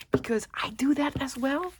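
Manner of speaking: whispered aside, half to herself; whispered admission. Real, not acted. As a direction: let it slip out in a whisper. Confessing she does it too.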